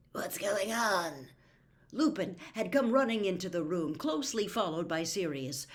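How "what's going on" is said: slow, hushed whisper; urgent whisper. A narrator's reading. Lupin runs in and asks, whispering. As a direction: breathe the question in alarm.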